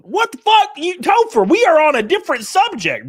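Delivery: high, loud shocked protest; shocked outburst. Real, not acted. Streamer yelling at Topher over sad dog message, shocked and loud. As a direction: blurt it out in disbelief.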